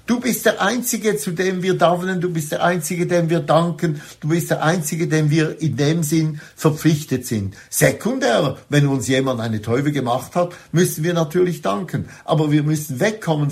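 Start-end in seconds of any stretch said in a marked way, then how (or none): none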